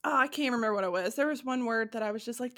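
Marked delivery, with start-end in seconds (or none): none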